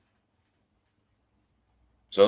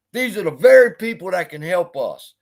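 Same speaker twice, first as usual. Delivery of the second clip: louder, higher angry outburst; fired up; 5 semitones higher, 7 dB louder than usual. Real, not acted. To viewers about the streamer's insults, loud angry outburst. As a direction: shout the point home in frustration. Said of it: "Now I done got fired up".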